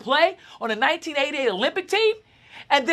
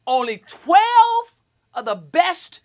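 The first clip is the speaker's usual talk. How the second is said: drawn out; 3 semitones higher, 4 dB louder, much slower than usual. Real, not acted. Emphatic medal claim.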